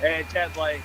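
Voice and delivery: ordinary talk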